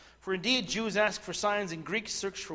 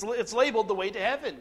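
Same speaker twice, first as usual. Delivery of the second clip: raised, higher-pitched preacher's voice; emphatic preaching; 5 semitones higher, 7 dB louder than usual. Real, not acted. Preacher warning congregation that false gospels cannot save, emphatic preaching. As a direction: preach it forcefully, driving home each key word.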